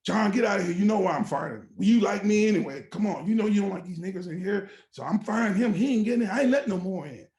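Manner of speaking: loud, insistent boss waving off objections; retold angry dismissal. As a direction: imitate a boss arguing openly. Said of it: "They're just talking like this".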